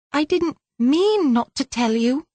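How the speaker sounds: put-on small girl, guilty and pleading; a high little girl owning up; young girl's anxious apology; child's voice. Guilty child Belinda speaking.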